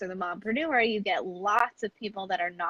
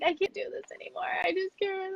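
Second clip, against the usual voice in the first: high, fast, softer mock whimper; retold despair; 11 semitones higher, 5 dB quieter than usual. Woman retells crying in bed to her husband, high and whiny. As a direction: imitate yourself giving up, a little whiny. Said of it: "laying in bed crying, just sobbing".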